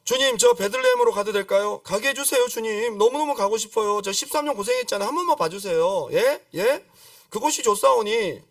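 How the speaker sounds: high-pitched mock begging; pleading. Ordinary believer begging the Lord for Bethlehem, hammed-up pleading.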